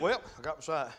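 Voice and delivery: low, soft, underwhelmed murmur; mock indifferent. Preacher as a healed blind man heading home, mock indifferent. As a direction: play down huge news as if it were nothing. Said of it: "got ready to go home and said".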